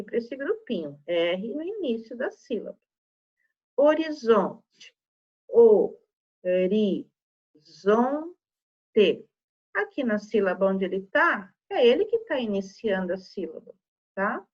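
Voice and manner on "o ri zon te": "O, ri, zon, te" com pausa entre as sílabas; separando sílabas. Shown as a demonstration. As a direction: diga "O... ri... zon... te", cada sílaba isolada. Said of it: "Se eu separar sílabas". ("O, ri, zon, te" silabado devagar)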